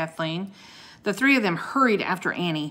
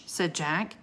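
usual speaking voice

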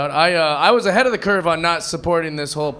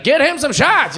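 cheerful bartender; 9 semitones higher, 5 dB louder, slower than usual. Retold or imitated. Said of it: "they'd be like".

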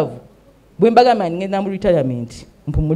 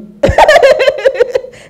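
loud high laugh; 9 dB louder than usual. Real, not acted. Laughing at her own boast.

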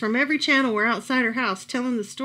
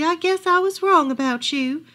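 sadly; 6 semitones higher, 5 dB louder than usual. A narrator's reading. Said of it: "the old woman said sadly".

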